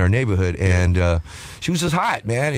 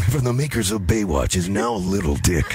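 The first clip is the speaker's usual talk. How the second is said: mock trailer voice; 5 semitones lower than usual.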